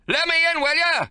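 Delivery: high, loud bellowing demand; angry demand. Acted. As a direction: bang on the door and bellow it. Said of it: "he demands again".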